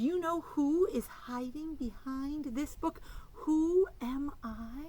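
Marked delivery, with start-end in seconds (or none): none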